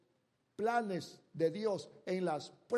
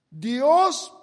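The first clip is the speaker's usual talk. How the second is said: Dios rung out loud and high; proclaiming loudly; 6 semitones higher, 14 dB louder than usual. Acted. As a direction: proclaim Dios to the whole room. Abraham proclaims God provides.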